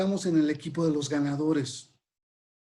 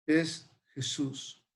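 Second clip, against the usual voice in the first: ordinary delivery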